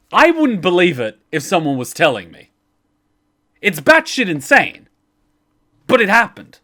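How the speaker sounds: emphatic insistence. Real, not acted. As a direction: hammer home that it really happened. Insisting the story is true.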